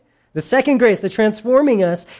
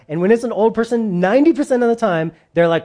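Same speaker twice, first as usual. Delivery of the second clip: ordinary delivery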